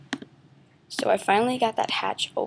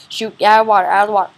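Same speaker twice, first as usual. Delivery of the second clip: loud panicked urgency; loud urgent shout; 11 dB louder, much faster than usual. Real, not acted. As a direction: yell it, fleeing in a panic. Fleeing the creature.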